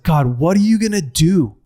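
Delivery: retelling a raw, pleading outburst to God; anguished cry to God. Retold or imitated. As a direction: cry out to God, begging for an answer. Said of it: "I literally say this".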